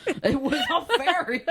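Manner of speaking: very high laughing squeal; mock alibi. Pretend suspect blaming a fairy, high mock protest. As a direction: squeal the excuse through a laugh.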